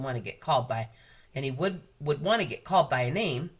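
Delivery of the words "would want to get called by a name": ordinary delivery, nothing marked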